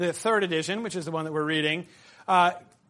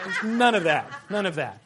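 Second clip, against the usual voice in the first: ordinary talk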